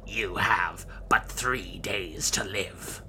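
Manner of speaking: slow, breathy sorcerer's whisper; eerie whisper. Acted. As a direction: hush it like a sinister fortune teller. Necromancer's verdict.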